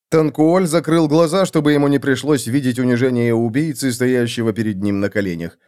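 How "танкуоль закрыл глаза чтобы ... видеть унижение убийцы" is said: ordinary delivery, nothing marked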